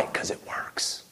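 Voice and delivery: breathy stage whisper, hushed; a whispered aside. Acted. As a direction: lean in and whisper the secret. Preacher's aside on the enemy.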